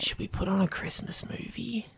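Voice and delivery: unvoiced ear whisper; whisper in left ear. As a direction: whisper it close and soft. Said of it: "whispering into your ear".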